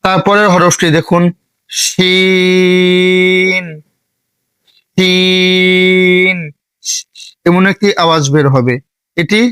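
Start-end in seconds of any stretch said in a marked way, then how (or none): 1.7-6.4 শিন held long, about four seconds; drawn-out Arabic letter. Shown as a demonstration. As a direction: stretch শিন slowly like a Quran teacher. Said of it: "চার second টানবেন". (শিন লম্বা টেনে উচ্চারণ)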